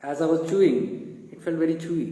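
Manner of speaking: usual speaking voice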